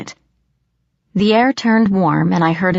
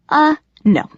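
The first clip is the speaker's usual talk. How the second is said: quick; 10 semitones higher, 4 dB louder than usual. A narrator's reading. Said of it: "he said quickly".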